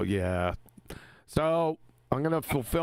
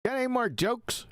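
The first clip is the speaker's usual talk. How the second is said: pitched way up, teasing the ranter; 11 semitones higher than usual. Real, not acted. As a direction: tease him in a high, playful voice. Teasing after the rant.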